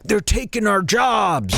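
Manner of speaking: high, hollered dumb-townsperson cry; mocking South Park voice. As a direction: holler it to mock stupid people. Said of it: "that's the voice and tone that everybody used to do".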